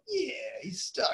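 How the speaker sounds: high, soft, drawn-out delight; quietly pleased. Retold or imitated. Watching a stoked partner enjoy it, quietly pleased. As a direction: savour it slowly in a high, soft voice.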